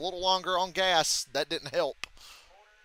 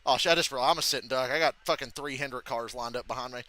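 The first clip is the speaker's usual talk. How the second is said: ordinary delivery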